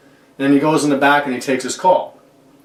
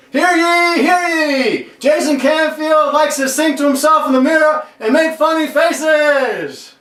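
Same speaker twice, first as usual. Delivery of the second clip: high, ringing town-crier call; public proclamation; 11 semitones higher, slower than usual. Pretend. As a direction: proclaim it mockingly to everyone, holding the ends. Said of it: "I'm gonna make a public proclamation".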